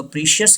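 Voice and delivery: "प्रीशियस" as PREE-shee-us; long EE and three syllables in "प्रीशियस"; wrong pronunciation. Shown as a demonstration. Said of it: "ये wrong pronunciation है". (गलत "प्रीशियस", लंबी ई के साथ)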